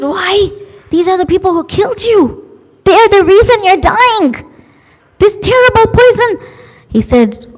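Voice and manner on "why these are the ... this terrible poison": high, fast, shocked objection; indignant protest. Retold or imitated. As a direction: she can't believe he'd reward his killers. Servant protests gift to killers.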